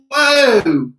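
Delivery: a drawn-out stunned whoa; mock surprise. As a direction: act floored by the picture. Reacting to the purse photo.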